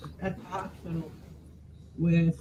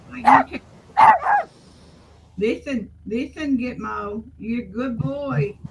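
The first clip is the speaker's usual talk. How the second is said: dog talk; 4 semitones higher, 5 dB louder than usual. Real, not acted. Soothing the dog.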